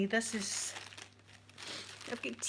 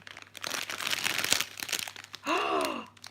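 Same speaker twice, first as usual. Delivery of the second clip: long, voiceless gasp of surprise; delighted gasp; 3 dB quieter than usual. Real, not acted. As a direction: suck in air in amazement. Finding a treat in the parcel.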